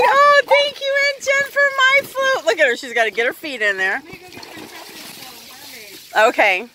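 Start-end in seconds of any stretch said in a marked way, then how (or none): 0.0-2.4 high, gushing pet voice; voicing the pet. Owner speaking as the dog thanking Aunt Jen, high pet voice. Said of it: "She's like".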